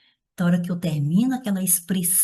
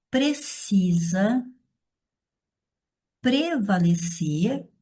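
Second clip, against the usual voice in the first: slow dictation; much slower than usual. Shown as a demonstration. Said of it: "dictating while writing".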